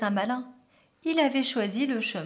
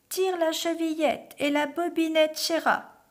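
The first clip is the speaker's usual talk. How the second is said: high, raised put-on granny; imitated grandmother; 7 semitones higher, 4 dB louder than usual. A narrator's reading. Wolf luring the girl in, imitating grandmother's high call. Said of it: "en imitant la voix de la grand-mère".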